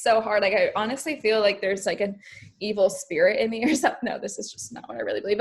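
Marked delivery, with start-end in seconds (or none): none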